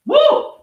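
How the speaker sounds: sudden high loud venting whoop; angry whoop. Real, not acted. Streamer furious at diabetes numbers, high loud whoop. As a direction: let the frustration burst out in a yell.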